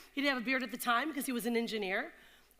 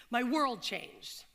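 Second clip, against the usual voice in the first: ordinary delivery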